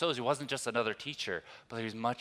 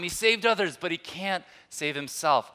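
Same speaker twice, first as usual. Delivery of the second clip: raised, loud imitation of a taunt; mocking quote; 7 semitones higher, 5 dB louder than usual. Preacher quoting those who mock Jesus, loud imitated taunting. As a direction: toss it out as a high jeer. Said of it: "'Cause they mock him".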